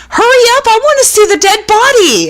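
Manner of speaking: put-on high, impatient kid's whine; blurting child. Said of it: "my child blurted out".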